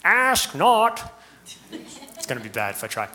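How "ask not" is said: a Boston-accented ask not, pitched up and louder; attempted Kennedy Boston accent. Shown as a demonstration. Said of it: "I can't do the Boston accent".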